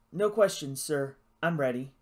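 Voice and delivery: usual speaking voice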